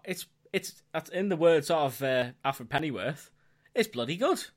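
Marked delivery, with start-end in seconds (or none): 3.7-4.4 hammed-up Cockney, pitched up; Cockney accent. Podcaster quoting Alfred Pennyworth to his co-host, bad Cockney accent. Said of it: "That was my accent".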